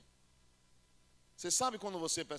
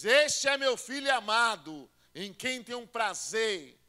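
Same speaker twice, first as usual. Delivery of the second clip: loud, slow, higher proclamation; voice of God; 5 semitones higher, 12 dB louder, much slower than usual. Retold or imitated. God proclaiming his beloved son from heaven in a grand voice.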